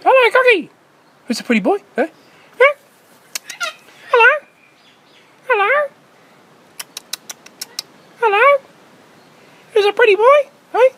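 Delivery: very high, loud sing-song calls to a cockatoo; bird-talk voice. Real, not acted. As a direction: call up to the parrot like talking to a pet bird. Calling to cockatoos in trees.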